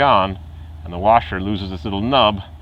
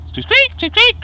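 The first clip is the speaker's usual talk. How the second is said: very high-pitched squeaks; sudden piercing squeaky noise; imitated squeak; 5 dB louder, slower than usual. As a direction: squeak like a bad bearing. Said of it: "That was going".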